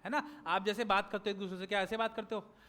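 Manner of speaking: ordinary delivery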